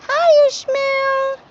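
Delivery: high, slow sing-song coo; pet talk. Real, not acted. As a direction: coo a greeting to the cat. Cooing to the cat.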